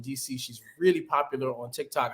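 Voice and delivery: ordinary delivery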